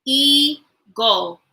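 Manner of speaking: "Eagle" in two drawn-out parts with a gap; syllable split. Shown as a demonstration. Teacher counting syllables for the class, syllable split. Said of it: "How many syllables does eagle have?".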